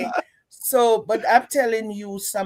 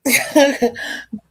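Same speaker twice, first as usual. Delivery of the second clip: bursts into high laughter before speaking; real amused laugh; 6 semitones higher, 5 dB louder than usual. Real, not acted. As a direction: laugh out loud at the start. Laughs before final word.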